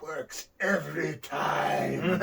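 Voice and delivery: very slow, smug low aside; sly aside. Retold or imitated. Fuji to his partner after the trick, slow smug aside.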